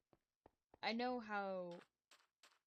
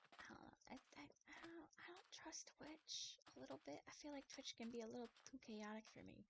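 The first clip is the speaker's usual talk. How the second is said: near-whisper, much softer and higher; hushed confession; 6 semitones higher, 10 dB quieter than usual. Real, not acted. Streamer admitting they don't trust Twitch, hushed. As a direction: confide it in a small hushed voice.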